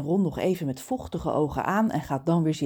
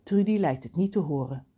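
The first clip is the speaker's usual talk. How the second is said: ordinary delivery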